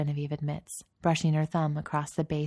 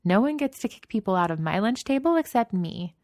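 firm and higher, laying down the law; 5 semitones higher, 4 dB louder than usual. A narrator's reading. Danielle firmly claims her lunch table from intruders. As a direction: stake your claim on the table. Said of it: "Danielle says firmly".

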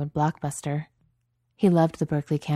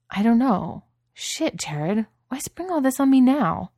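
much higher, louder, rising frustration; exasperated disbelief; 8 semitones higher, 4 dB louder, slower than usual. Acted. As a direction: throw up your hands in disbelief. Chad overwhelmed by news.

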